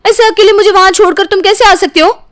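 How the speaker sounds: husband left alone at the movies, shouting angrily; angry outburst. Acted. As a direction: blast her for leaving you alone.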